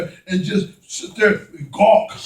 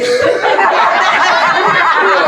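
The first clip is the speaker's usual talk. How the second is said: real laugh, higher and hearty; 6 semitones higher, 3 dB louder than usual. Real, not acted. Preacher laughing with the congregation, real laugh. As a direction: let out a laugh at yourself.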